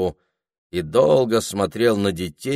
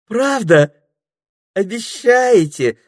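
high, eager, slow question; the snake suddenly delighted at the promise; cheered up; 7 dB louder, slower than usual. Acted. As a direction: perk up like a child promised a treat. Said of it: "повеселела Дульчебелла".

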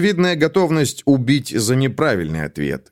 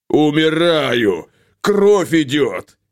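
slow hammed-up wail of pain; overacted death cry; mock dying wail; 3 semitones higher, much slower than usual. Ingvar fakes dying cry.

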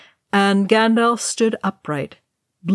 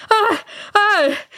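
high, loud wail; two loud wailing cries of dread; terrified wail; 4 dB louder than usual. A narrator's reading. Elf wailing as the monster surges near, terrified wail. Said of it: "Legolas wailed".

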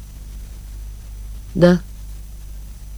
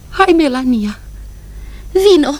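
calling out; 7 semitones higher than usual. Acted. Woman summoning her sister home, calling. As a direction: call her home with force.

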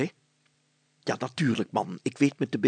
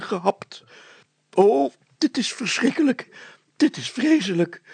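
high, loud, pained stammer; injured mayor groaning a reluctant denial; 9 semitones higher, 7 dB louder than usual. Acted. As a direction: groan it out slowly in a much higher voice. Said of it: "kreunde de burgemeester".

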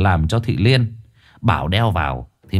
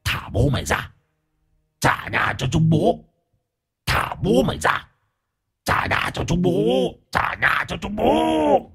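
ghost's demand; 7 semitones higher, 4 dB quieter than usual. Acted. The ghost warning the couple to give back its house, eerie hushed chant. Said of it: "Nó nhìn chừng chừng vào chị Liên".

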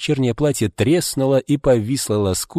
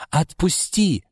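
much higher cry; screamed; 9 semitones higher, slower than usual. A narrator's reading. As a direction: shriek to be let go. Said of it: "закричала Марго".

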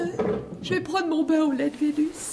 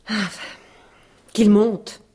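normal talking voice

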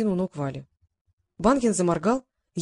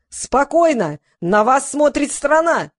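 loud, higher-pitched command; shouting; 5 semitones higher, 5 dB louder, much slower than usual. A narrator's reading. Host Roza calming a fight on air, shouting. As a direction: shout over a brawl to restore order. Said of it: "Роза заорала".

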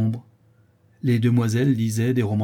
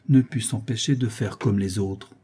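ordinary delivery, nothing marked

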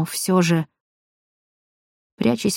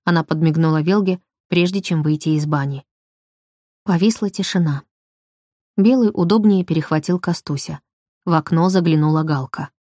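ordinary delivery

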